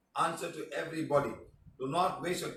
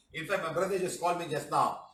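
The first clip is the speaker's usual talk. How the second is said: ordinary delivery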